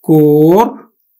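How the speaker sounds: করবার very slow, first vowel o: কোর-বার; o-kar pronunciation. Shown as a demonstration. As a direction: split করবার as kor, pause, bar. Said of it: "আমরা উচ্চারণ করি কিন্তু". (ধীরে ও-কার দিয়ে কোর-বার)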